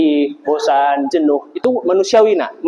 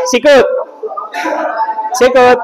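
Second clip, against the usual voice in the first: game commands; 6 semitones higher, slower than usual. Real, not acted.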